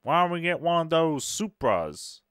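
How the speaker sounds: put-on a quick, hammy Alabama accent; a put-on Alabama twang, badly done. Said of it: "That was a bad Al- Alabaman accent".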